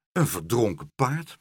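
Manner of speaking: ordinary delivery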